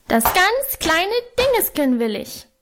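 put-on high-pitched toddler wail; high childish howl of demand. A narrator's reading. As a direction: wail it like a small boy. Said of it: "heulte er".

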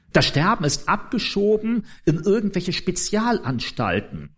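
normal talking voice

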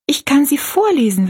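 put-on high-pitched, willing girl; lifted, girlish storybook voice; girl's voice. A narrator's reading. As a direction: offer it as a young girl. Narrator voices young Rosalie offering.

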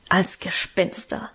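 slow, secretive whisper; big brother revealing the ghost plan in a slow whisper. A narrator's reading.

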